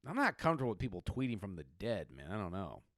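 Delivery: ordinary talk, nothing marked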